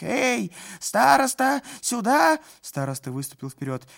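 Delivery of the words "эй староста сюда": high, loud summoning yell; shouting. A narrator's reading. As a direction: bellow for the elder. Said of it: "закричал исправник".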